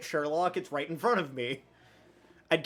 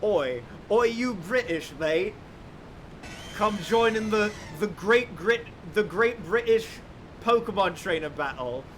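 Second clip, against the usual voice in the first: mock British accent; 2 semitones higher than usual. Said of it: "what Great Britain is like".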